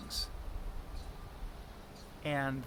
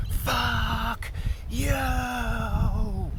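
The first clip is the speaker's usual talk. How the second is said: raw higher yell with a cry in it, at the top of the lungs; furious yell; 8 semitones higher, 5 dB louder than usual. Retold or imitated. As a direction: let it rip like rage bursting out. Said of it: "and I yelled".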